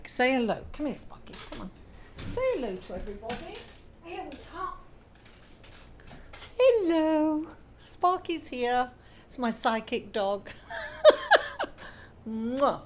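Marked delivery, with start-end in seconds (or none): 0.1-8.9 squeaky praising a dog. Real, not acted. Greeting her dog on camera. 10.5-12.0 high squeal of laughter. Real, not acted. Laughing at her psychic dog joke.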